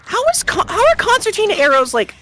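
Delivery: shocked and baffled; incredulous. Real, not acted. Incredulous outburst.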